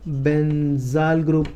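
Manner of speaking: Benzal drawn out in two long parts; unhurried, stretched benzal; slow dictation. Real, not acted. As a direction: say benzal slowly, as if writing along. Dictating the term while writing.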